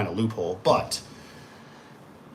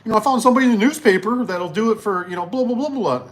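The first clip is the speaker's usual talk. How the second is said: higher-pitched nagging wife, chattering on; mock wife; 10 semitones higher than usual. Wife telling husband about a newspaper find, mock wife voice. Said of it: "wife says".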